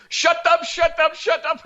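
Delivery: high, squawked repeated outburst; mock angry yell. Scammer cutting off the caller, mock angry yelling.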